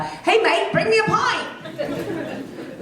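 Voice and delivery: high, drawn-out mock British call; put-on British accent. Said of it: "I was in England for two weeks".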